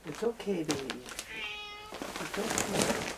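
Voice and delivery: high, soft reassuring coo; gently calm a frightened pet; soothing a scared cat. Real, not acted.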